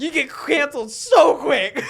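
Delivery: shouted through laughter, slow; cracking up while saying it; laughing. Real, not acted. Joking with a friend about cancel culture, laughing loudly.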